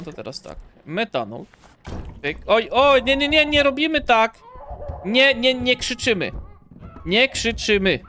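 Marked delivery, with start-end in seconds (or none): 2.5-8.0 loud, raised-pitch telling-off; scolding shout. Pretend. Player telling a screamer to stop yelling, scolding shout. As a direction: shout it down like an unruly child.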